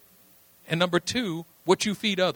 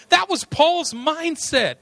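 ordinary talk

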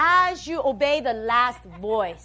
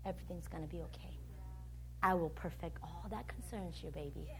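very soft, low reassurance; hushed, low fatherly comfort; loving Father's voice; 7 semitones lower, 15 dB quieter, faster than usual. Acted. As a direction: soothe a child tenderly, barely above a murmur. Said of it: "hear the voice of your Father saying".

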